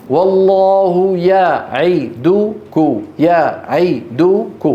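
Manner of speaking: يعدك drawn out evenly, very slowly; slow careful tajweed; even slow recitation. Shown as a demonstration. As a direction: stretch each vowel the same. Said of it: "الطول واحد".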